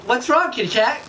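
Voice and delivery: taunting villain voice. Acted. Acted taunt in a put-on voice.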